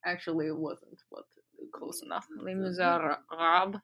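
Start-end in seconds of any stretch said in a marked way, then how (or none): 2.4-3.8 slow "Les Miserables", reaching for a throaty R; throaty French R attempt. Shown as a demonstration. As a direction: carefully try "Miserables" with a back-of-throat R. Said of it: "that was full Russian".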